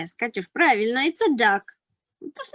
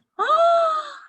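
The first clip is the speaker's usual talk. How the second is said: high sharp gasp of surprise; surprised gasp; 5 dB louder than usual. Acted. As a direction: gasp as if startled. Wind blows letters again.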